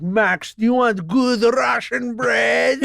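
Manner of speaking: slow, laughing, put-on dad voice; dad's hammed-up voice. Father offering his son bread each morning, hammed up and laughing. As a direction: sell the bread to your son, hamming it. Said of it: "I was like".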